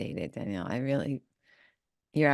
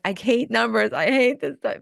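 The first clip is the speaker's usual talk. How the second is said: loud, higher-pitched mock complaint; lightly hammed-up exasperated outburst; mock frustration; 5 semitones higher, 6 dB louder than usual. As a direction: act out an exasperated client griping. Voicing a frustrated last-minute taxpayer.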